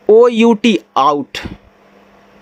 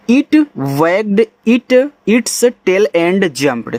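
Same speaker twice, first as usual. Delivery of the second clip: slow reading; much slower than usual. Shown as a demonstration. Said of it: "reading sentence for pupils".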